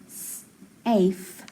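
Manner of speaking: "safe" split as s, pause, afe; sounded out. Shown as a demonstration. Teacher decoding a coded word for class, "safe" sounded out in parts. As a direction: hold the "s" apart from the rest of "safe". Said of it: "So I have".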